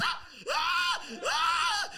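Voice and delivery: very high, loud repeated shrieks; short piercing yelps, one after another; freaking-out screams. Retold or imitated. Comic imitating his panicking son, high screams. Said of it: "He's freaking out, just going".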